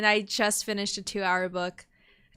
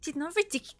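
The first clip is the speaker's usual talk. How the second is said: lightly put-on British accent, higher and softer; 4 semitones higher, 5 dB quieter than usual. Streamer retrying a character's line, light British accent. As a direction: try the line in theatrical British. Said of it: "I give her a British accent".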